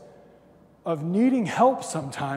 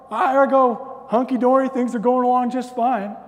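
high, loud, breezy mock contentment; exaggerated all-is-well voice; hammed-up cheerfulness; 8 semitones higher, 9 dB louder than usual. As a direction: play carefree, high and loud. Acts out his oblivious good mood.

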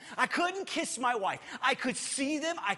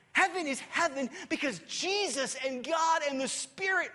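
full-force preaching; preaching shout; 7 semitones higher than usual. Acted. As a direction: declare it to the back row. Preaching emphatic answer.

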